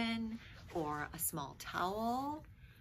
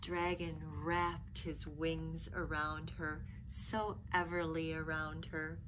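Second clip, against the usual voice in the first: put-on expressive read-aloud for children; lively picture-book reading voice; storybook narration; 3 semitones higher, 9 dB louder, slower than usual. A narrator's reading. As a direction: tell it like a bedtime story.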